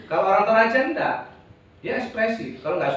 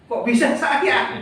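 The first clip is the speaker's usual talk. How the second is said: mock surprise; 6 semitones higher, 5 dB louder, slower than usual. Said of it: "Tuhan ngomong gini".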